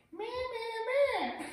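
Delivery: very high squeaky toy bleat; a creepy toy going meh. Retold or imitated. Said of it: "it's like".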